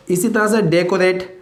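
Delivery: usual speaking voice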